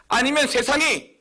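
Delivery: usual speaking voice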